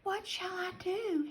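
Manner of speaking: high, soft little rabbit whisper. Acted. Little rabbit asking a squirrel for help, soft high whisper. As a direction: ask it softly in a small high voice. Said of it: "he whispered to a squirrel".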